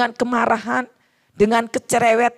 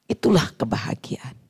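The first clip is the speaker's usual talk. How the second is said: whispered; 4 semitones lower than usual. Acted. Preacher whispers the key line.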